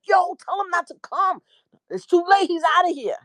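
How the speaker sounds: high, hurried frantic warning. Retold or imitated. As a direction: warn urgently. Frantic phone call.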